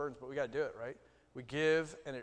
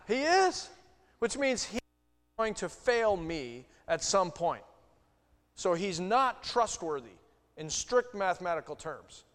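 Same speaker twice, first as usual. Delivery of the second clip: high, loud, slow emphatic preaching; preacher hammering home the logic of trust; forceful preaching; 6 semitones higher, 11 dB louder, slower than usual. Real, not acted. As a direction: drive the point home hard.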